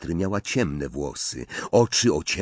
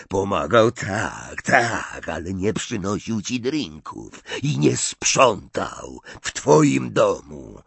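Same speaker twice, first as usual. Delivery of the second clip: scornful, louder; 3 semitones higher, 4 dB louder than usual. Acted. Snape scorns Wormtail.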